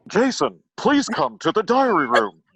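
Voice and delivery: slow, loud announcer impression; Don Woolman voice. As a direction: call him in like a TV announcer. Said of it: "let me try an impression here".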